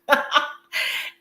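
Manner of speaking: airy high laugh, no voice; shared giggle; breathy laugh. Real, not acted. Laughing with the technician.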